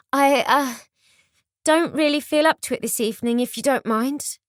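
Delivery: high, nervous and wavering; wobbly voice. A narrator's reading. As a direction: stammer it out nervously. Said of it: "she said in a wobbly voice".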